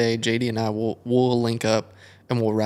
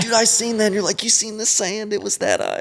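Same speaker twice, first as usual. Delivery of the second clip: much higher, stoked rider voice; amazed hype; 10 semitones higher than usual. Retold or imitated. Rider behind a guy who rails, amazed. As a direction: gush about the roost like a fanboy. Hyped rider after a rail.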